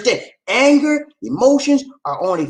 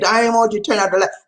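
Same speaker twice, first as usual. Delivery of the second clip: ordinary talk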